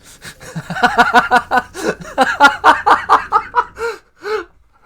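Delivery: loud high cackling laugh; burst of loud laughter. Real, not acted. Gamer cracking up at the robot's insult, loud laughter. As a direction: crack up hard at the joke.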